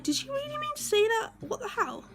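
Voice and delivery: high-pitched, incredulous what-the-hell; stunned disbelief. Retold or imitated. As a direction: voice shooting up in shock. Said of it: "me and Claire are looking at each other like".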